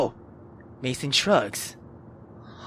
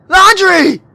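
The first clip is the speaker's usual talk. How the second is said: loud high desperate call; shouting a name; 7 semitones higher, 8 dB louder than usual. Acted. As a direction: scream his name across the street. Calling out for Laundry.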